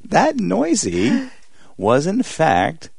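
put-on high, slow cooing baby-doll voice. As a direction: coo it like a talking toy doll. Mimicking the doll's cooing noise.

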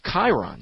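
"Chiron" as KAI-ron; hard K, long i in "Chiron"; stated pronunciation. Shown as a demonstration. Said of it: "if that's pronounced Chiron".

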